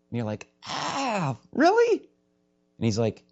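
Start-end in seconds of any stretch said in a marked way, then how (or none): 0.6-2.0 high, gasped disbelief; mock dismay. Believer hearing God wants more, high mock dismay. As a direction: squeak it out in exaggerated dismay.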